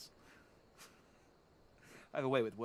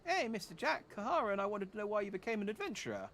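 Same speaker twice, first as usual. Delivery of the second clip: put-on much higher, eager kid voice; a curious little child, chirpy; 8 semitones higher than usual. Child asks the hero.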